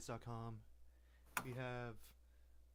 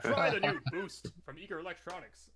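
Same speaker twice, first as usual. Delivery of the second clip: ad announcer; 4 semitones higher, 6 dB louder than usual. Pretend. Mock commercial tagline, laughing.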